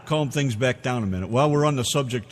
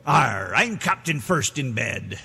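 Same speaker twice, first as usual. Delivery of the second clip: pirate voice; slower than usual. Pretend. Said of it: "say something in pirate talk".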